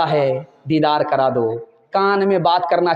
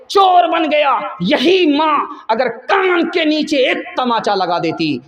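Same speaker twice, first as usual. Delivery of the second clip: crying out; 7 semitones higher, slower than usual. Acted. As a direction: voice breaking as he blames her. Son breaks down over mother.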